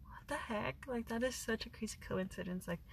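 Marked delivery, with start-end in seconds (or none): 0.0-0.8 hushed whisper. Retold or imitated. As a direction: breathe it out in disbelief. Retold stunned reaction, unvoiced.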